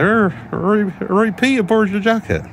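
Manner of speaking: put-on very high-pitched Bristolian accent; comic high Bristolian granddad impression; hammed-up Bristolian granddad. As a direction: put on a Bristolian old man, pitched right up. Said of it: "too much of a Bristolian accent".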